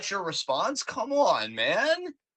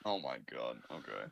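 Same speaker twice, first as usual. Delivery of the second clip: very low voice; 13 dB quieter than usual. Real, not acted. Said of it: "how low my voice can get".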